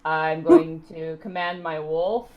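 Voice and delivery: normal talking voice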